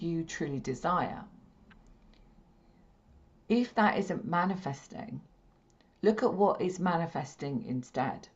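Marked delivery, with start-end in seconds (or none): none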